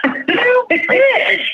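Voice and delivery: very high-pitched indignant squeal; mock outrage. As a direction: act out the outraged comeback, way up high. Acting out angry comeback to tattletale.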